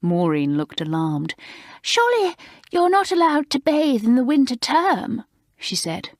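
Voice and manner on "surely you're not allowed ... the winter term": high, prim, gushing schoolgirl voice; prim new girl. Acted. Maureen, alarmed, telling the fifth formers off, high and prim. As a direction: play the prim girl shocked at the idea.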